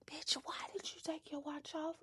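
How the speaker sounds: unvoiced, teasing whisper; whispered self-scold. Scolding herself in a whisper for taking the watch off. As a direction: hiss it at yourself in mock reproach.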